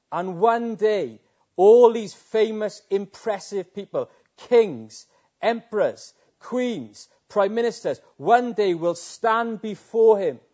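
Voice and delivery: slow, loud rising list of rulers; building proclamation. Acted. Preacher warning the crowd of judgement, slow loud proclamation. Preaching climax, kings before him.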